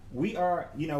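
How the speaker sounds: usual speaking voice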